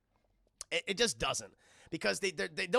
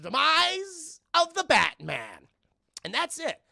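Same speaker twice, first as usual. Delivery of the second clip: dramatic narration; 12 semitones higher, 9 dB louder, slower than usual. Retold or imitated. Dramatic toast retold.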